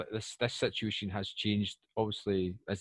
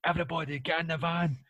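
urgent command; 8 semitones higher than usual. Pretend. Telling zombie survivors, high and urgent, to get in the van. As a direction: shout at the group to get in the van. Zombie escape, rallying people.

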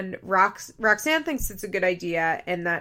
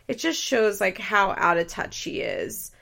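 normal talking voice

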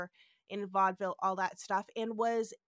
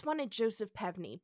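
normal talking voice

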